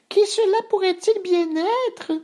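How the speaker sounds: tiny, very high voice; wondering baby bear. A narrator's reading. Bébé Ours wondering about the intruder, high baby squeak. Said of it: "se demanda Bébé Ours".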